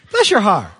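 loud mock Southern sweetness; mock blessing. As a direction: punch it home. Repeats the Southern phrase.

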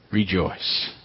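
"rejoice" dropped low and soft, falling; quiet repeat. Shown as a demonstration. The pastor repeats the word to his church softly and low. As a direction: say it again quietly, almost to yourself. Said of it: "I'll say it again".

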